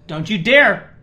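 sharp, loud, half-joking warning. Real, not acted. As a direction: stop him mid-sneeze, loudly. Warning co-host not to sneeze.

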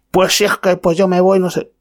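high, huffy imitation of the man storming off; mock shouting customer. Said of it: "Dando voces". (imitando burlón los gritos del cliente, mucho más agudo)

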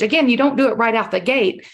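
usual speaking voice

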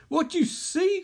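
high, excited villain voice; eager villain. Acted. Scarecrow eagerly questions his victim.

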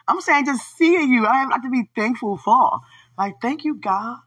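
loud, high, stretched-out gushing; worked up. Real, not acted. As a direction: keep it high, loud and overexcited. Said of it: "let me calm it down".

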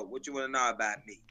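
ordinary talk, nothing marked

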